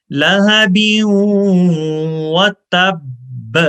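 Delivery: loud, slow model recitation of لہب و تب; careful recitation. Shown as a demonstration. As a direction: say لہب then stop, then و تب slowly. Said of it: "تو غور سے سنیے".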